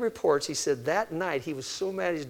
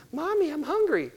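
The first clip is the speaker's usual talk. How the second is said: put-on much higher, a small girl whining for food; little Susie whining; 12 semitones higher than usual. Preacher voicing little Susie as a whiny child. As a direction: play the hungry three-year-old. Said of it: "what does little Susie say?".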